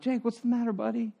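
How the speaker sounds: put-on soft, hushed check on a child; quietly coaxing an upset kid; gentle concerned dad. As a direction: ask softly, like a dad at the door. Father asks crying son through door.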